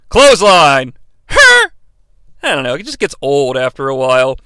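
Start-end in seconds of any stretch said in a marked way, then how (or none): 1.3-1.7 high loud barked grunt; mock arena grunt; imitated bullhorn-style crowd grunt. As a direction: bark it like the crowd chant. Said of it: "and you hear".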